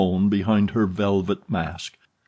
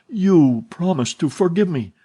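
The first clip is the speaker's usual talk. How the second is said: much higher, louder begging; pleading; 9 semitones higher, 5 dB louder than usual. A narrator's reading. Young man begging the heroine's forgiveness, pleading. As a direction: beg her to keep her promise.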